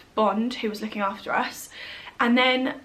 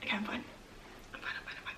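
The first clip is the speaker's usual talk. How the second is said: much softer, fading to a whisper, holding back tears; steadying herself through crying; real tears; 17 dB quieter, much faster than usual. Real, not acted. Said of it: "I'm getting teary".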